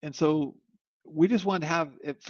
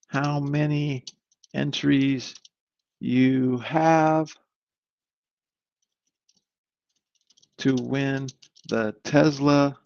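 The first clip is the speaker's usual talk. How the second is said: drawn-out ad announcer read, word by word; slow promo read; 2 semitones lower, much slower than usual. Acted. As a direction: spell out the giveaway slowly like a commercial.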